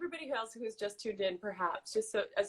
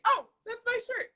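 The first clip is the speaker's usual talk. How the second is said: high-pitched mock outrage over a shirt; mock roommate squabble; 4 dB louder than usual. Roommate catching her friend in borrowed clothes, high mock protest. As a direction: pitch it way up, playful indignation. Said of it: "We're like".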